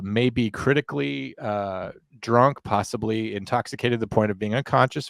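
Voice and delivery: ordinary talk, nothing marked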